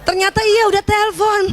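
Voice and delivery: usual speaking voice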